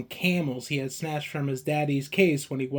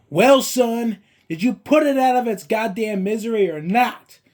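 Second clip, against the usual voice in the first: higher and louder, a furious father losing his temper; angry raised voice; 7 semitones higher, 5 dB louder than usual. Acted. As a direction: let the contained rage burst out at the son. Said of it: "he raised his voice at me".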